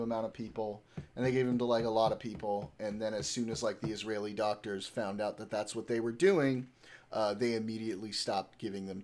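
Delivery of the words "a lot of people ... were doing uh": ordinary talk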